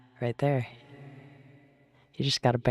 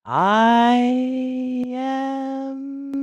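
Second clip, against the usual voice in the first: wizard voice; 10 semitones higher, 4 dB louder than usual. Pretend. Starts wizard proclamation again.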